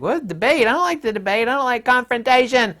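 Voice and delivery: high, pinched whiny protest; mock whiny avoider. Mimicking people who dislike debate, high whiny complaint. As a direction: whine like someone scared of an argument.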